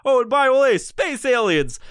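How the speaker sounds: hammed-up high rant to a barista; oversharing rant. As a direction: pounce on a stranger with wild trivia. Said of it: "go out to fucking Starbucks and go".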